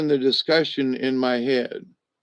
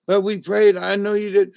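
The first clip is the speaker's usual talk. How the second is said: fussing friend; 7 semitones higher, 4 dB louder than usual. Retold or imitated. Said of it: "she was like".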